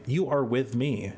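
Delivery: plain reading voice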